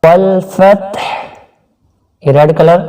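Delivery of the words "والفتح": slow chanted tajweed recitation; Quran recitation. Shown as a demonstration. Teacher demonstrating recitation to students, slow and chanted. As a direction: draw out each syllable in melodic recitation. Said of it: "Urdu تلفظ دیکھیے".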